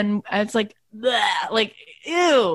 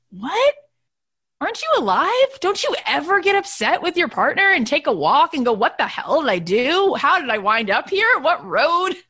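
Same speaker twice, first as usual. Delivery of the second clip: much higher, disbelieving reply; incredulous; 8 semitones higher than usual. Real, not acted. Wife answering worried followers, much higher and incredulous. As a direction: push back, amazed anyone would worry.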